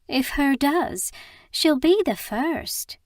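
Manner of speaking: doubtful West Country barmaid tone; West Country accent. Acted. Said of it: "with a thick West Country accent".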